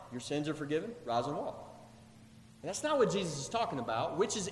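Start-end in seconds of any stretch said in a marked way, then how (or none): 0.1-1.6 low, soft, throwaway reading; casual murmur, dropped in pitch; easy, offhand recital. Shown as a demonstration. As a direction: toss both phrases off as effortless to pronounce. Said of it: "they're equally easy to pronounce".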